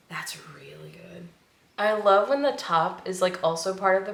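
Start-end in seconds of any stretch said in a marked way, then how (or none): 0.1-1.3 soft whisper after a sniff; whispered delight at a scent; whispered awe. Real, not acted. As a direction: breathe it out in delight. Sniffing a candle.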